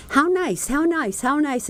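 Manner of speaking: high, singsong mock of a repeated pleasantry; mocking imitation. Friend mimics her repeated reply.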